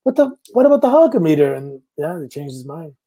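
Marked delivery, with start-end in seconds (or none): 0.0-1.6 high, retold startled "what?"; shocked disbelief. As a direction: replay the moment you heard the news, stunned. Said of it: "I'm like".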